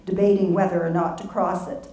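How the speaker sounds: normal talking voice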